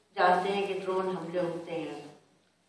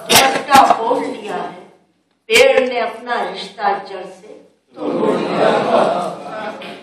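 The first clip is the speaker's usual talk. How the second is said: measured declamation, key phrases swelling then falling away; poem recitation; 9 dB louder than usual. Acted. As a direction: recite to a hall, pausing after each phrase. छोटी सी नज़म है.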